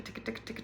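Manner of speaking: ticka ticka said very soft with teeth closed; quick quiet ticka, jaw still; ticka drill, teeth together. Shown as a demonstration. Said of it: "Thirty seconds here and there of ticka".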